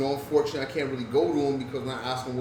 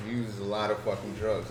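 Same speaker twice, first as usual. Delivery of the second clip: ordinary delivery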